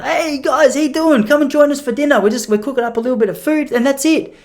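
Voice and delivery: beaming, high, eager welcome; over-the-top friendliness. Retold or imitated. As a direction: lay the friendly charm on thick. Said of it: "little bit of Popplestone friendliness".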